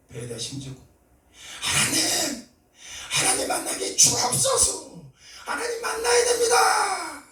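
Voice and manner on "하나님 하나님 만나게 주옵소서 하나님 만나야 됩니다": desperate shouted prayer. Retold or imitated. 넥타이가 풀리도록 기도하며 높고 크게 외치는 간구. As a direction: pour everything into the plea, slow and shouting. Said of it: "넥타이가 풀어질 정도로 제가 기도하고 있었어요".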